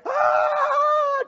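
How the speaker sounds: long, very high shriek; loud, sustained scream as a frightened child; piercing mock-child wail; child's scream imitated. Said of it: "Dia langsung teriak".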